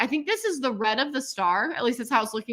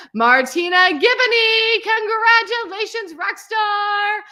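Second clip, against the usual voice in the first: much higher and louder, drawn out; proud host calling the name; excited announcement; 10 semitones higher, 8 dB louder, slower than usual. Real, not acted.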